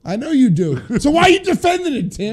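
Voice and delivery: high, loud challenge; shouting. Real, not acted. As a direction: yell it back, arguing. Heated city argument.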